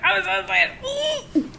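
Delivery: imitating someone's high, drawn-out excitement; high, stretched-out mock squeal. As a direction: squeal it slowly, putting on another person's excitement. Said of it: "Erin's like".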